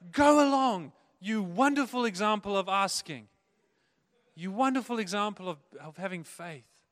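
hammed-up benevolent praise; grand commendation. Bearded ogre praising the child, grand and loud.

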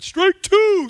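high, loud umpire call. Retold or imitated. Umpire calling strike two, loud high call. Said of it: "said the umpire".

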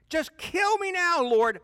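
very high, loud, slow anguished cry; desperate cry. Acted. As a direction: hit the top of the voice, pleading. Repeated, even more desperate.

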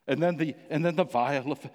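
ordinary talk, nothing marked

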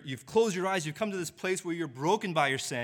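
ordinary talk

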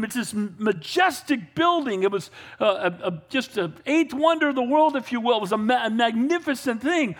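ordinary delivery

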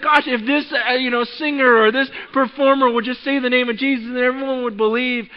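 high, loud, sustained mock excitement; eager enthusiasm. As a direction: gush with naive hope. Mimics naive hope in celebrity believers.

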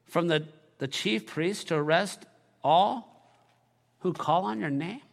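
hesitant objection. Acted. Ananias warily warns the Lord about Saul, hesitant and halting. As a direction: cautiously raise a concern, pausing between phrases.

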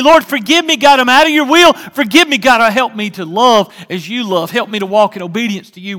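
mock repentant prayer, loud and high; loud pleading prayer. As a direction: cry out to God for forgiveness, full voice. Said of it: "voiced prayer of repentance".

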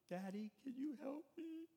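put-on high, small voice of a frustrated little girl; little cry. Little daughter asking daddy for help, high and tearful. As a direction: beg like a tiny child near tears. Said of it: "this little cry".

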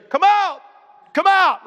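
high, loud call repeated; mock commanding shout. Walking through a cemetery calling the dead, mock shout.

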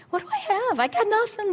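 high, loud helpless outburst; frantic pleading. Retold or imitated. Preacher re-enacting her prayer to the Lord, frantic. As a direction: throw up a desperate plea.

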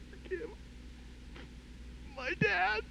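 a grown daughter barely getting the words out; high, tearful forgiveness; choked plea. Retold or imitated. Woman forgiving her father, weeping.

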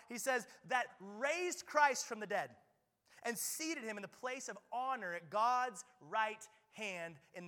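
full-force sermon delivery; preaching at full force. Acted. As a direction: drive it home from the pulpit.

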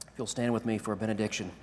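ordinary talk

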